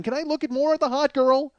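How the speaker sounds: high, pleading mock whine; whiny protest. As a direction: squeak it out as he's shoved away. Pleading while pushed out door.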